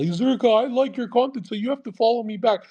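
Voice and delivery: high, mocking fan voice; mocking whiny fan. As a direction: mimic a needy follower begging. Mocks a short follower's demand.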